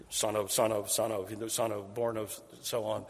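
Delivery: soft, hurried run of son of; quick quiet listing. Acted. Preacher skims the genealogy in a soft rush. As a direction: rattle the names off under the breath.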